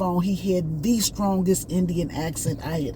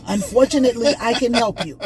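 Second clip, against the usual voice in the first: a hint of an Indian accent, raised higher and a bit louder; light Indian accent; 4 semitones higher, 5 dB louder than usual. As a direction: repeat the agent's words with a light Indian lilt. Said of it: "I'm trying to talk to y'all at the way he was talking".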